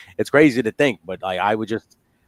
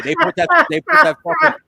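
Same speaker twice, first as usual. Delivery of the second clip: high, louder excited rant; excited outburst; 5 dB louder than usual. Real, not acted. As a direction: build up the joke. Mocking wife's authority.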